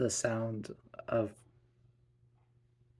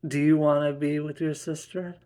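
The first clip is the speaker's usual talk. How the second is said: louder, higher parental challenge; stern warning; 4 semitones higher, 6 dB louder than usual. Acted. Mother warning her gloating daughter, stern. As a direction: warn the child off.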